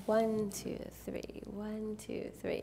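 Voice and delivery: one two three fading to a whisper; soft, hushed slow dance count. Shown as a demonstration. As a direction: count it gently so they copy you. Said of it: "say it slow and soft".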